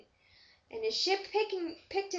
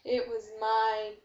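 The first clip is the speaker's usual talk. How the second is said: ordinary delivery, nothing marked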